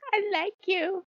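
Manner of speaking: cutesy god voice. Retold or imitated. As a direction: play the god sucking up sweetly.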